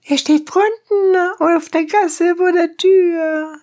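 very high donkey-driver voice; high-pitched peasant character. A narrator's reading. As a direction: answer high and eager in character. Voices donkey driver answering the judge.

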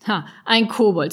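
mocking laugh. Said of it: "Sebastian lachte laut auf".

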